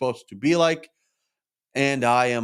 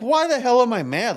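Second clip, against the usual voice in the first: higher and louder, incredulous at himself; exasperated self-scolding; 10 semitones higher, 6 dB louder than usual. Real, not acted. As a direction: snap at yourself in disbelief. Scolding himself for being mad.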